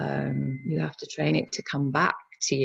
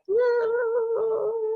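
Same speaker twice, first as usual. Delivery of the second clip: very high, drawn-out whooping noise; wild mind noise; 4 dB louder than usual. Acted. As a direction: let out a long crazy high whoo. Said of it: "your mind's just like".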